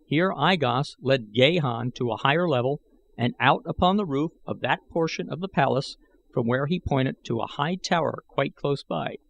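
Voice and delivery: ordinary delivery, nothing marked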